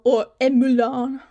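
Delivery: mock French accent. Hams up a French word.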